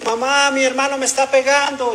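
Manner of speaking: mock child's wail. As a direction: imitate a kid crying out to mom. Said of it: "empezó a gritar y a llorar". (imitando a un niño que se queja llorando)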